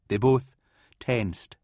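ordinary delivery

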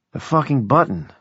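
ordinary delivery, nothing marked

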